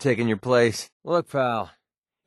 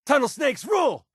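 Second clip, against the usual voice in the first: very high-pitched rallying yell; gleeful shouted slogan; 3 dB louder than usual. Acted. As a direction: yell it high like a gang cheer.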